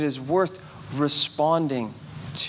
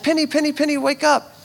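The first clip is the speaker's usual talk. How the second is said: higher sing-song wake-up call; waking daughter; 9 semitones higher, faster than usual. Retold or imitated. Dad waking six-year-old Penny, high sing-song call. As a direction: coax a child awake, high and bright. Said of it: "I said".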